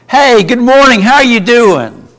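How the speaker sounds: bright, high, loud good morning; cheery greeting. Shown as a demonstration. Teacher demos a cheerful morning hello, loud and high. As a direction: greet them with big enthusiasm. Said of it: "I say the same words, but communicate a totally different message".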